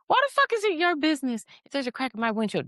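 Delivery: high-pitched indignant outburst; retold indignation. Driver griping about the windshield ticket, retold indignation. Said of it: "getting more and more angry".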